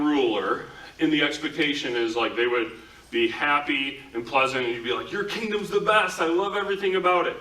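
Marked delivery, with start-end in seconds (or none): 5.1-7.4 high, fast fawning praise; mock flattery. Courtier gushing at the foreign king, high, fast mock flattery. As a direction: gush over the king like a courtier.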